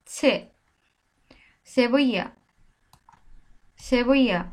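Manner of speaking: 「সেবইয়া」 said with an s and a y sound; Spanish Sevilla. Shown as a demonstration. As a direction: say 「সেবইয়া」 clearly, falling at the end. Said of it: "সিকে বলছি".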